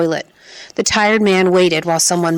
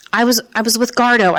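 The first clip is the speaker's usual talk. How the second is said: higher, stumbling shout; 5 semitones higher than usual. Acted. Boy shouts the truth at the policemen, panicky and higher. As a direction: blurt it out in a panic. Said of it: "I shouted".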